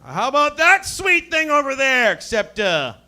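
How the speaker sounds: high, loud crude bro; squealing bro. Retold or imitated. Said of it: "I gotta be like".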